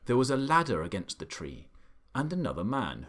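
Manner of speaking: plain reading voice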